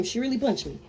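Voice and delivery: ordinary delivery, nothing marked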